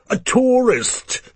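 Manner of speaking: high, slow snapped punchline. A narrator's reading. Drunk diner snaps punchline at the waitress. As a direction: snap it out like a sneering toff. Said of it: "Another snaps".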